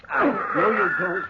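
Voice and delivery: slow, forced shout while wrestling; effortful yell; straining shout. Acted. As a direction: bellow with all your strength holding on. Doctor holding struggling creature.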